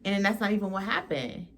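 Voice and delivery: ordinary talk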